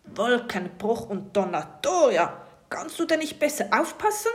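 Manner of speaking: laut und hoch polternder Pirat; brüllt wütend durchs Zimmer; lautes Schimpfen. A narrator's reading. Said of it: "Da hörst du es laut und deutlich". (polternder Ruf, langsam gedehnt)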